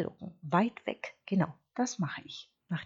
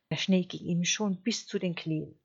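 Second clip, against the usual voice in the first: ordinary delivery, nothing marked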